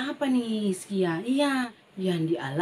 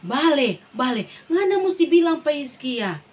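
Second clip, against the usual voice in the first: insistent command; 4 semitones higher, 6 dB louder than usual. Acted. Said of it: "Apa le Tuhan".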